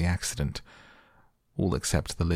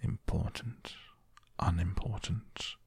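soft, near-whispered musing to oneself; hushed, hesitant word-testing; undertone; 3 semitones higher, 7 dB quieter, slower than usual. A narrator's reading. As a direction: try out each word slowly under your breath. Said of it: "went on to himself in an undertone".

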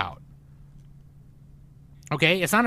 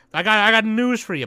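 louder and higher, heated rant; real heated yell; 6 semitones higher, 5 dB louder than usual. Real, not acted. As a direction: lay into him like a fed-up fan. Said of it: "I didn't mean to yell".

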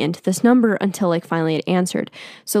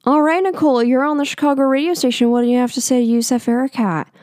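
radio host; 4 semitones higher, faster than usual. Retold or imitated. Radio host putting Nicole on air to talk to Yusef, fast and high. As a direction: play the upbeat radio DJ going live. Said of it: "they're like".

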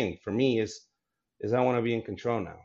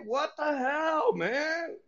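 much higher, slow, whiny mock complaint to his cousins; exasperated high whine; much slower than usual. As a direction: squeak it out like an offended kid. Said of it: "I'm like".

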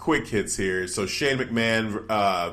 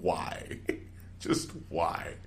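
soft, breathy whispered plea; imitated whisper; 7 semitones lower, 12 dB quieter, much slower than usual. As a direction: mimic a crushed wrestler begging under his breath. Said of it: "looking at him like".

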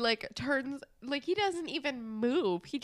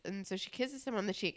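usual speaking voice

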